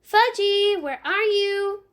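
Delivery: high, loud call across the yard. Acted. Sheila calling out across the yard for Fudge. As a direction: call for him, looking around. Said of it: "She turned away from me and called".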